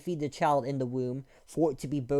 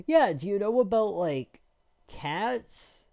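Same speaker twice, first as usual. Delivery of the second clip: mocking dumb voice; 5 semitones higher, slower than usual. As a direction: play the smug questioner. Mocking people asking about cats.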